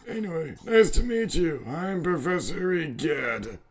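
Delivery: lightly voiced old man professor; a hint of a doddering old scientist.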